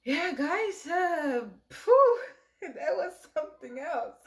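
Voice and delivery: emotional, blowing out the tears; tearful laughing. Real, not acted. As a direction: laugh it off through leftover tears. Recovering from crying on camera.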